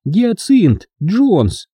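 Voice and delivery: high, drawn-out, slightly faltering confession. A narrator's reading. Hilda admits her full name, voice faltering slightly. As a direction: stretch out the name in a high, reluctant voice. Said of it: "Её голос на мгновение дрогнул".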